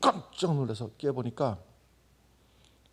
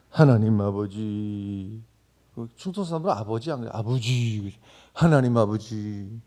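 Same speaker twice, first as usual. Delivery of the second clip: Chungcheong dialect; 4 semitones lower, 4 dB louder than usual. Retold or imitated. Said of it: "충청도 말로다가". (느릿한 충청도 사투리 기도)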